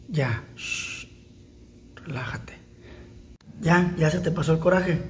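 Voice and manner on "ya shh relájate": whispered, soft and low; calming a scared cat in a whisper; soothing whisper. Real, not acted. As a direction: hush the animal gently without voice.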